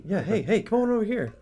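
high, eager beckoning; Micah calling. Acted. Micah calling the wandering Levite over, high and keen. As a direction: wave him over with a high, keen call.

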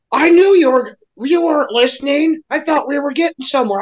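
mock the wounded lover; lightly voiced indignant partner; offended partner.

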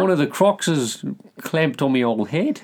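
hammed-up Australian telling a mate; acted Australian caller. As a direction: play the croc victim ringing for help. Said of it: "like, he called up and".

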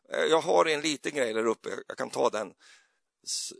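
ordinary delivery

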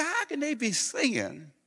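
high-pitched voicing of amazed prisoners; quoting listening prisoners. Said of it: "They say".

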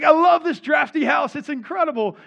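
high, loud, elated exclamation; overjoyed. Retold or imitated. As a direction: act out a man reborn, thrilled with everything. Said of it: "kind of reborn".

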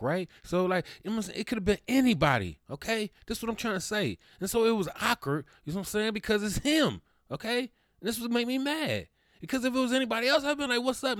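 venting, worked up and indignant; real exasperated outburst; heated rant. Real, not acted. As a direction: voice climbing high and loud, words tumbling out fast. Worked-up rant about his mother.

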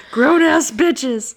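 high, loud, slow laughing repeat. Real, not acted. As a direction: crack up while saying it.

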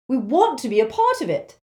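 angry. A narrator's reading. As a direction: insist angrily on being included. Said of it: "said Neville angrily".

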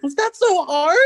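high, loud sarcastic voice; mocking Thad. Thad after the slap on the ass, high mock sarcasm. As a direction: needle him with it. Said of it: "And he's like".

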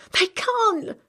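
high, loud panicked cry; girl's protest. Acted. Girl over the injured boy, panicked. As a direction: refuse in terror.

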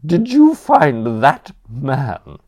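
loud, appalled grande dame cry; a scandalised aunt booming out the word; consternation. Acted. Aunt outraged at the butler, loud appalled gasp. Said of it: "vibrated with consternation".

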